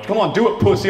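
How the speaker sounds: high, loud peer-pressure taunt; mock goading. Comic as friends pressing him to go shooting, mock goading. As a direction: egg him on like a pushy pal.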